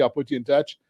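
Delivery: ordinary talk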